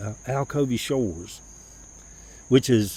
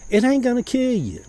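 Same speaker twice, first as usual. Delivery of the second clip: a hint of his mother, scolding high; mama's voice; 11 semitones higher, 5 dB louder than usual. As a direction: preach it like mama would. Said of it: "My mama's took over this now".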